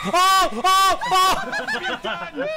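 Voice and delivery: startled yelps. Real, not acted. Startled by monster, laughing.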